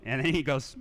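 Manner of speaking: ordinary talk, nothing marked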